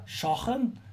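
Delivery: a quizzical Scouse "shocking?" with a rising end; echoing the word in a Scouse accent. Pretend. Repeating it in a Scouse accent for the stream chat. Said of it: "why are we speaking in a sort of Scouser accent".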